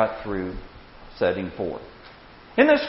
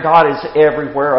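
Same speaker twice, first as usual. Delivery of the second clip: ordinary talk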